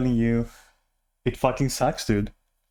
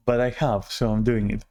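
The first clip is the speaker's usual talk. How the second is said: ordinary delivery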